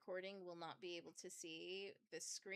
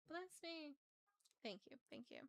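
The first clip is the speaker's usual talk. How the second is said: ordinary talk, nothing marked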